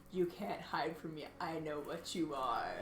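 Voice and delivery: very low, hushed threat; menacing message. Acted. Druid sends a magic message to the cat, hushed menace. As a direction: murmur it low like a secret warning.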